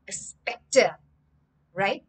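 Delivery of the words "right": ordinary delivery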